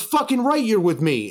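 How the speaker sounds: high-pitched, hyped-up approval; retold excited welcome. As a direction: act out his thrilled yes. DJ welcomes him onto the stage.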